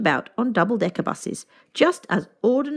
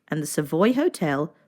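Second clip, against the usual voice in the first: ordinary delivery, nothing marked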